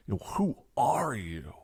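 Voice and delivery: breathy awed whisper of who are you. Shown as a demonstration. Teacher showing an awed reaction, whispered. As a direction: whisper it, stunned.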